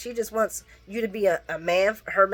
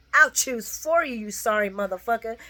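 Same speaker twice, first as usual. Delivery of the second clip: mock furious rant; 6 semitones higher than usual. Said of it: "Trina's like".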